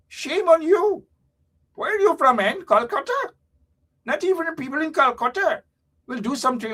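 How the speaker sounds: high-pitched mock Indian accent scolding. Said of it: "No, that's Indian voice".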